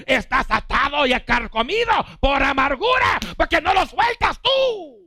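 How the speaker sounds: high, loud rebuke; shouting preacher. Acted. Preacher scolding the bitter ones, high loud shout. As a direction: shout it at them, no mercy.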